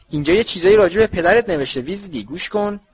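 high, loud mocking announcement; loud for all to hear. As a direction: make the whole hall hear it. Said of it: "خیلی بلند، طوری که همه بشنوند".

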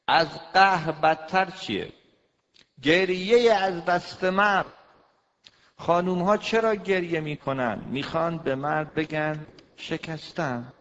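loud, high fiery sermon voice; preaching. Acted. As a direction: hammer the point home to the women. Preaching at full force.

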